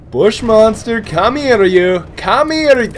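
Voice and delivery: high, loud taunt; taunting call. Real, not acted. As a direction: call the enemy out playfully. Taunting enemy during gameplay.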